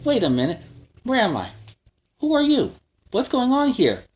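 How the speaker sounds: lost and dazed, asking everyone; confused hero. Acted. Confused Hawkeye wakes up.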